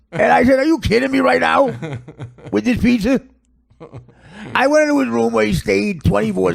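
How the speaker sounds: hammed-up aggrieved dad; exasperated father ranting about his son; put-on dad voice, pushed hard in complaint; father imitation. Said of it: "The father talks like this".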